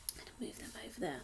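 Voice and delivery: fast hushed whisper. Real, not acted. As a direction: mutter it under your breath quickly. Whispered aside while moving cards.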